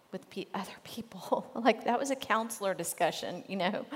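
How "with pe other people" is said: breathy whisper with a giggle; whispered aside. Real, not acted. Aside, unvoiced.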